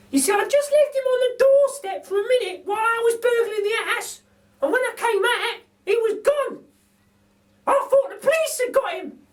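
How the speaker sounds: a cheeky cockney burglar lady; Burglar Betty. A narrator's reading. Betty's held character voice.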